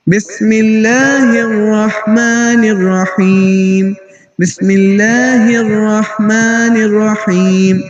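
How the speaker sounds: الرحمن slow, ha of اللہ from the chest; slow recitation demo. Shown as a demonstration. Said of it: "difference پتہ چل رہا ہے؟". (بسم اللہ آہستہ، اللہ کی ہا سینے سے)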